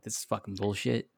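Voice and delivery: low, soft grumble of disgust; quiet disgusted grumble; muttered disgust. Retold or imitated. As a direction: mutter it under your breath, fed up.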